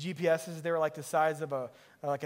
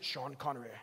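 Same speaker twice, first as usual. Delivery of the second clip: low, soft attempt at a Scottish accent; lightly attempted Scottish accent, dropped low; Sean Connery; 6 semitones lower, 9 dB quieter than usual. Shown as a demonstration. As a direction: try a rough Scottish actor impression. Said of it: "I can't do the Scottish accent".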